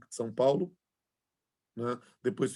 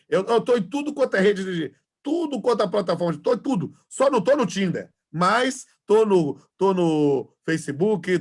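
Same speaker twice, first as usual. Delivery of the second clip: excited; 5 semitones higher, 8 dB louder, slower than usual. Real, not acted. Boasting about being on every platform.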